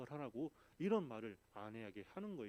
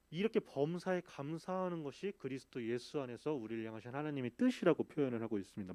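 ordinary talk, nothing marked

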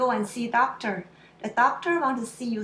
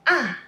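"Ah" starting high and dropping sharply; short, loud falling "Ah"; fourth tone; 3 semitones higher, 7 dB louder than usual. Shown as a demonstration. Teacher demonstrates fourth tone, sharp falling "Ah". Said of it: "starts high but drops sharply".

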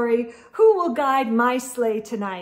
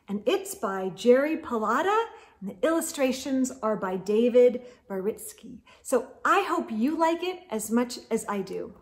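plain reading voice

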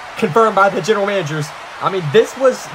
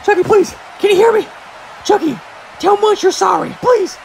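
high, loud desperate pleading; pleading shout; 12 semitones higher, 4 dB louder than usual. Acted. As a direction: beg him to get up, yelling. Pleading with downed wrestler.

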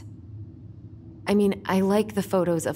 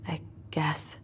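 soft unvoiced mumble, barely there; whispered; 6 dB quieter than usual. Real, not acted. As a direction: breathe it out, half to yourself. Quiet reluctant reply to friend.

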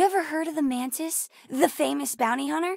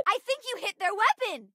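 high-pitched, eager report; excited battle callout; 8 semitones higher than usual. Acted. Child telling the pilot about a weapon hit, excited.

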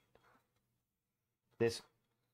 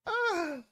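yelp. Real, not acted. Misses another match.

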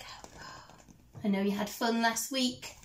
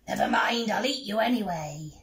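hungry troll; 3 dB louder than usual. Acted. Troll threatening mouse.

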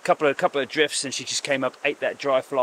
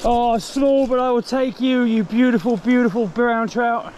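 high, loud and thrilled, slowing on the last words; gushing over a lovely fish; delighted; 9 semitones higher, 4 dB louder than usual. Real, not acted. Angler gushing to the brown trout he just caught, high and delighted.

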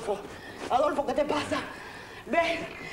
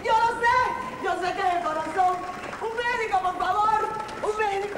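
panicked plea; 6 semitones higher than usual. Acted. Wife pleads desperately for a doctor for her husband. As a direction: beg for a doctor in terror.

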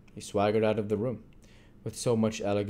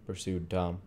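ordinary delivery, nothing marked

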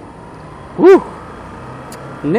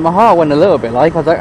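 normal talking voice

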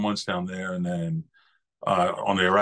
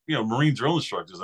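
usual speaking voice

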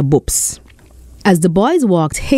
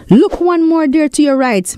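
high, eager call up the tree; excited exclaim; 9 semitones higher than usual. Acted. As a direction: point out the mango with excitement. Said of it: "Bello exclaimed".

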